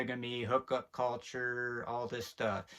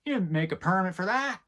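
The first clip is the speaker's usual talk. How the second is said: higher, lightly voiced cop scolding; mock cop voice; 6 semitones higher, 3 dB louder than usual. Imitating cops telling homesteaders off, mock scolding. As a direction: play a petty cop busting homesteaders. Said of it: "Let the cops come be like".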